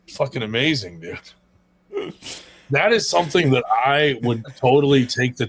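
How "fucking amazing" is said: ordinary delivery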